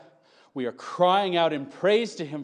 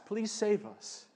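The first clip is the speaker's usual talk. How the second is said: quiet plea; 4 semitones higher, 7 dB quieter than usual. Shown as a demonstration. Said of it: "it's a request".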